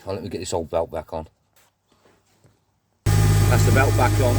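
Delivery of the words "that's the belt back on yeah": straining. Real, not acted. Straining while fitting belt.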